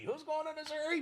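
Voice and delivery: high deadpan horse puppet. Acted. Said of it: "his voice is really close to my normal voice".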